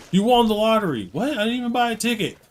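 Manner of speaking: high, loud game-show-style surprise; hammed-up amazement at a pretend win; mock excitement. Acted imaginary lottery win skit.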